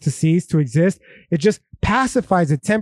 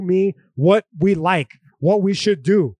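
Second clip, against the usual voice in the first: normal talking voice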